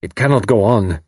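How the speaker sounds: "cannot" stressed in a level bitter voice; bitterly. A narrator's reading. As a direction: state "It cannot go on" flatly, with weight on "cannot". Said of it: "Karl went on bitterly".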